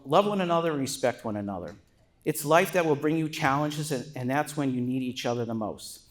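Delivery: ordinary delivery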